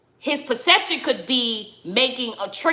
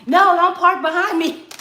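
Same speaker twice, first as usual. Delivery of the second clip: firm call; 6 semitones higher, 4 dB louder than usual. Retold or imitated. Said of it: "the way you was talking to me".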